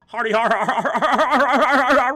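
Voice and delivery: high, loud mock "har har har" laugh; fake funeral laugh. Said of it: "That's how I laugh at funerals".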